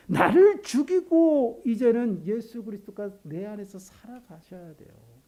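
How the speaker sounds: ordinary talk, nothing marked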